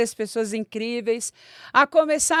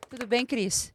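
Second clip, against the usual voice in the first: ordinary delivery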